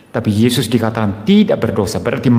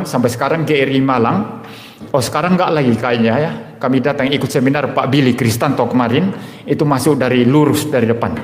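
ordinary talk

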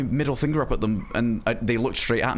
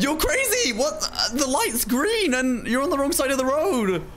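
very high-pitched, slow protest; indignant squeal; slower than usual. Real, not acted. Scolding a driver on the wrong side, high indignant squeal. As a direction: squeal in disbelief at a reckless driver.

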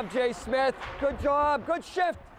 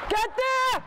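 shouting; 10 semitones higher than usual. Real, not acted. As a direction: urge the skater on with a yell. Coach yelling at skater to hurry.

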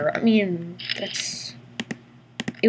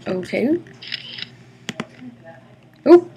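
normal talking voice

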